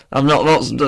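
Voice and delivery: ordinary talk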